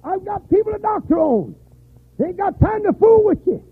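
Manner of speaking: high, loud, scolding bark; gruff doctor scolding. Retold or imitated. Hard-headed doctor to a disobedient patient, loud scolding. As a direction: tell off a patient who won't listen. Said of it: "hard-headed doctors ... say".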